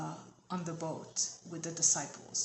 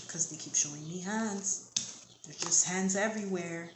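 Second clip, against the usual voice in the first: ordinary delivery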